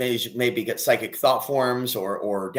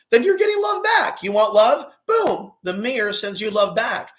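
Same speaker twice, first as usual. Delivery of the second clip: high, loud, impassioned outburst; fired-up shout; 9 semitones higher, 4 dB louder than usual. Real, not acted. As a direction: shout it high, rallying the group. Fired-up warning to hostile viewers.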